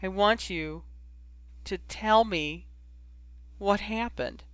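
choked-up, pausing request; barely managed. A narrator's reading. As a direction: plead in broken phrases, fighting tears. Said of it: "she managed".

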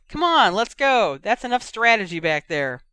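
loud call, starting high; calling from across. A narrator's reading. Teammate urging players to stop talking, shouted from afar. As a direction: holler them back to the game. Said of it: "a voice from across the pitch".

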